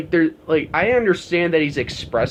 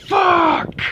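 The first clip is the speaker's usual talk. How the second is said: high, louder mock yell; Lars yelling; 4 dB louder than usual. Lars in James's face, imitated yell. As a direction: bark it like a bratty outburst. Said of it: "he goes".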